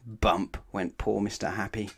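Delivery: ordinary delivery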